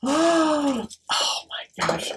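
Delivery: gasped, whispered amazement; awed whisper. Real, not acted. Vlogger seeing beautiful potion bottles, whispering in awe. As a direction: breathe it out in a hushed gasp.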